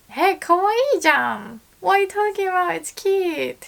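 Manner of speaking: high, emphatic disagreement; reassuring protest. Pretend. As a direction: insist she looks good, high and quick. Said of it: "I would say".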